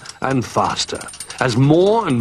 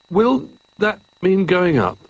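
higher-pitched nervous question; 8 semitones higher than usual. A narrator's reading. Giraffe dreading being lifted out by crane, nervous. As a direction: ask it uneasily, pitched up high. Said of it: "asked Jolly nervously".

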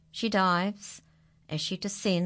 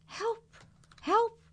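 much higher squeaky cry; animals crying help. Acted. The stuck animals call down for help.

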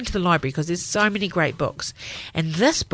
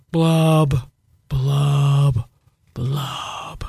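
slow sad fish bubbles; underwater blub blub; 5 semitones lower, 4 dB louder, much slower than usual. Acted. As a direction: drop each blub lower and glummer. Fish ends its refrain.

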